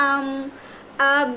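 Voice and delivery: "আম, আব" each closed on the lips with no vowel after; joined sukun consonant. Shown as a demonstration. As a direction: seal the final m and b of "আম, আব". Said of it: "আমরা মিলায়ে দিই". ("আম, আব" ঠোঁট মিলিয়ে, শেষে স্বর ছাড়া)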